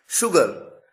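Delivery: "शुगर" begun with sh, never s; careful exception demo, sh opening "शुगर"; sh despite spelling. Shown as a demonstration. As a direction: model "शुगर" with a clear hushing first sound. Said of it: "उच्चारण में स नहीं बल्कि शलगम वाला".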